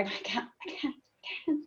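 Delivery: choked tearful whisper, breaking down; words fall apart into a tearful whisper; crying. Real, not acted. Guest tearing up retelling her mirror moment, choked whisper. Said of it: "like, I cry right now".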